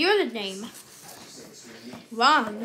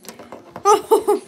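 loud giggle; laughing burst; 5 semitones higher, 6 dB louder than usual. Real, not acted. Laughs after the hit.

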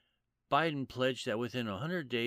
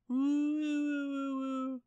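mock cheering; 3 dB louder than usual. Mocking cheer after the impression.

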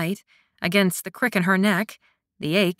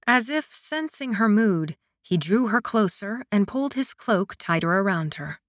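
usual speaking voice

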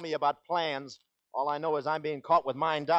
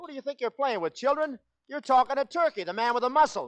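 blustering; 9 semitones higher than usual. Acted. Blustering threat to his partner.

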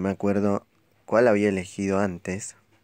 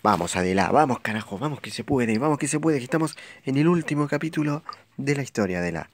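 rising excited cheer, pitch climbing; 6 semitones higher than usual. Real, not acted. Cheering on the game character.

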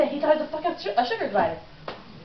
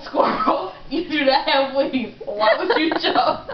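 real laughter bubbling through the words; laughing scolding; 3 semitones higher, slower than usual. Real, not acted. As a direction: break into laughter mid-rant. Cracks up during mock scolding.

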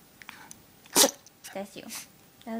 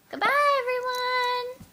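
high, loud goodbye, drawn out at the end; sing-song farewell; 13 semitones higher, 5 dB louder than usual. Real, not acted. Owner signing off to viewers, high sing-song goodbye. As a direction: call it out in a high pet-video squeal.

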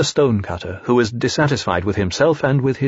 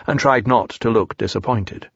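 ordinary delivery

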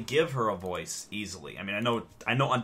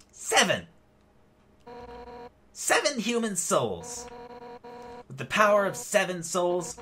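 higher-pitched put-on female voice; deliberate, declaring villain in a raised voice; Undyne; 5 semitones higher than usual. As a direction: proclaim it in an improvised female warrior voice. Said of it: "Undyne needs a voice".

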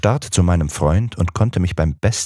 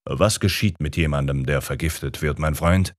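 ordinary delivery

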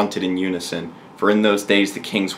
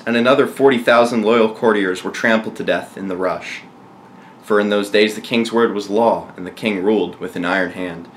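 plain reading voice

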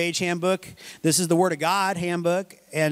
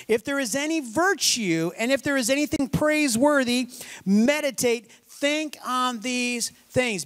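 higher, solemn reading, slowing at the end; scripture reading; 4 semitones higher than usual. Acted. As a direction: read the verse like a proclamation. Reads scripture at length.

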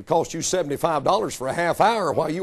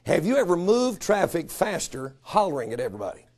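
ordinary delivery

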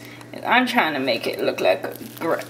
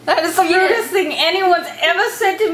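mock offended; 7 semitones higher than usual.

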